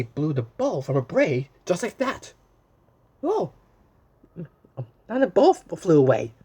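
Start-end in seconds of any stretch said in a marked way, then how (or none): 3.2-6.3 storyteller gasping at the loss; surprised dismay. Acted. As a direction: cry out as the bow blows off. Reacting to lost bow.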